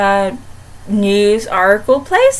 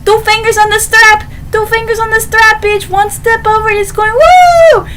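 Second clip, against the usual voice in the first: much higher, louder mocking taunt; taunting bully; 9 semitones higher, 4 dB louder than usual. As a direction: dangle the purse and goad her. Taunting Davina with her purse.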